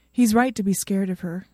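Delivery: ordinary delivery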